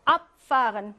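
"Abfahren" stressed on AB; first-syllable stress. Shown as a demonstration. Teacher drilling separable verbs, "Abfahren" stressed on AB. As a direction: hit AB at the start of "Abfahren". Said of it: "일 강세".

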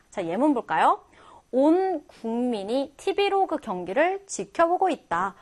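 ordinary talk

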